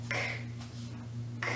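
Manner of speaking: bare voiceless "k", no vowel after it; isolated K sound. Shown as a demonstration. Teacher showing the last sound of walk, isolated voiceless "k". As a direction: click out "k" with breath only. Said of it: "there's no vibration".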